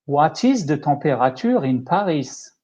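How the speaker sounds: English with a strong French accent. Shown as a demonstration. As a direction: ask it in a thick French accent. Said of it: "I will try to speak with a, with a French accent".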